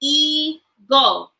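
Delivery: "Eagle" in two drawn-out parts with a gap; syllable split. Shown as a demonstration. Teacher breaking a word into parts, syllable split. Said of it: "Break words into parts with me".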